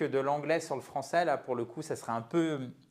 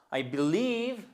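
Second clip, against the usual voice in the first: "believe" with a higher, stressed second syllable; 5 semitones higher, slower than usual. Shown as a demonstration. Teacher showing second syllable stress, lifted LIEVE in "believe". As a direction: lift and lean on LIEVE in "believe". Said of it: "seconde syllabe, le stress".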